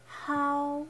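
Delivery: "How" held high and level; first tone. Shown as a demonstration. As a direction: stretch "How" on one high pitch. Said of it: "pronunciation tones".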